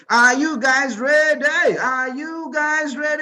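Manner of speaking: slow, loud, rising call to the crowd; rally the crowd like a hype man; drawn-out shout to get the audience going. Real, not acted. Host hyping up the audience.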